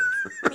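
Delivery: imitated high squeaky laugh; a high, childlike laugh; mimicking her daughter's high laugh. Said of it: "My daughter was like".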